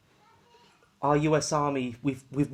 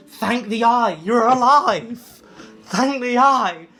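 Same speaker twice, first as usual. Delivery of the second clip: slow ecstatic shout; 8 semitones higher, 10 dB louder, slower than usual. Acted. GM voices Doc rejoicing.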